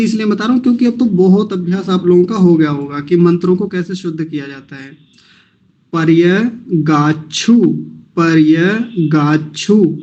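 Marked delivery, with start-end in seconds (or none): 5.9-10.0 पर्यगाछु said slowly, split into parts; broken into syllables. Shown as a demonstration. Teacher breaking the word for chanting, slowly. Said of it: "ऐसे तोड़ लेंगे".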